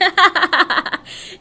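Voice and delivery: loud burst of laughter. Real, not acted. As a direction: break into laughter. Laughing at her own sexy voice.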